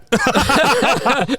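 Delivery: high, real cackling laugh; burst of laughter. Real, not acted. Host joking about the suppository story, high laughter. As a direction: crack up at your own question. Laughing at own cheeky question.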